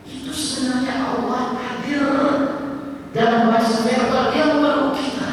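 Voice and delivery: high, loud fervent sermon; impassioned preaching. Acted. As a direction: preach it with rising fervour. Preaching at full force. (khotbah berapi-api)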